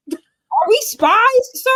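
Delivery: high, loud, stretched-out disbelief; mock incredulous. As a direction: demand an answer in exaggerated bafflement. Acted baffled reply to husband.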